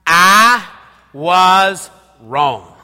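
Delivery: loud, drawn-out, with the crowd; congregation call-and-response. Shown as a demonstration. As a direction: lead the church in saying it. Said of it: "Say it with me".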